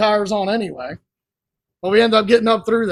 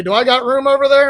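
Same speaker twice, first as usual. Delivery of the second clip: higher, louder retold shout across; called-out question; 6 semitones higher, 5 dB louder than usual. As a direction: imitate a driver calling to a spotter. Acts out JoJo asking Emily.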